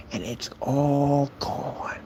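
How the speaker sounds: slow, hushed fake comfort; sarcastic soothing. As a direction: let it drift away softly. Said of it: "It's the same sarcasm I use".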